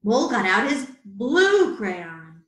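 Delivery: usual speaking voice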